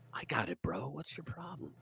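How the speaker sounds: a private whispered gripe at the screen; hushed muttered whisper to himself; whispered aside. Real, not acted. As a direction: whisper it under your breath. Muttered aside while busy.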